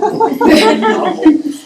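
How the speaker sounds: quick real laugh; amused little laugh; short laugh. Real, not acted. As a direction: let a laugh slip out. Laughing after her answer.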